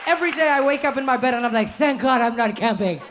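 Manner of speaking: waking in bed, thankful not to be camping, relieved. Acted. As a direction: sigh out your gratitude. Relief every morning.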